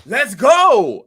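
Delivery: loud rallying coach shout; hype shout. Retold or imitated. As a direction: bark it like a trainer firing you up. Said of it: "Mick was like".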